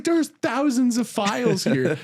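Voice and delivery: Ted voice. Retold or imitated. Ted staring at the laptop, high stunned voice. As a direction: stretch it out in high disbelief. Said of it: "He's like".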